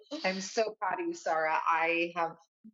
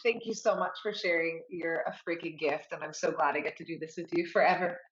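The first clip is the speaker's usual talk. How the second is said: usual speaking voice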